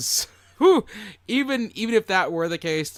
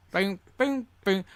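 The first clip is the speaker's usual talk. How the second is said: higher mouthed boom boom boom; vocal drum hits; 5 semitones higher than usual. Pretend. Co-host adding vocal drum hits to the bit.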